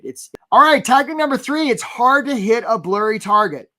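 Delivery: high, loud kickoff of the next point; energetic announcement. Real, not acted. Coach to job seekers, announcing tactic three high and loud. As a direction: pump it up like a host.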